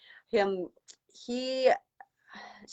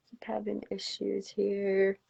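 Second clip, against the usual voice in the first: whispering; 7 dB quieter, slower than usual. Real, not acted. Muttered aside while reconnecting.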